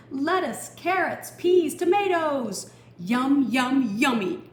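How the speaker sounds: high, loud gleeful listing; delighted. Acted. Gardener admiring his growing garden, loud and gleeful. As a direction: savour every vegetable name.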